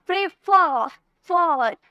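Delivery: Prefer with a punched फर, then फॉर repeated alone; stress on last syllable. Shown as a demonstration. Teacher demonstrating where strength goes, stressed end of prefer. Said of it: "एकदम जोर देकर बोल रहे".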